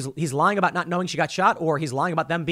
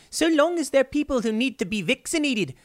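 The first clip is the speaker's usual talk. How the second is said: mock New Zealand accent; 7 semitones higher than usual. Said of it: "She was like".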